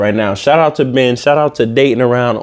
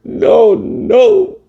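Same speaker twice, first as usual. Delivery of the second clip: mock sobbing; much slower than usual. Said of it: "he started crying".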